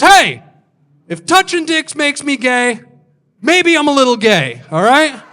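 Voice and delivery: high, loud, defensive yell; hammed-up shouted comeback; defiant outburst. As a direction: shout back an indignant admission. Acted defiant comeback in quarrel.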